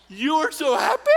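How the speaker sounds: put-on high, loud old-lady squeal again in full; imitating the old woman. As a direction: belt it just like the old lady did. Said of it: "Just like that".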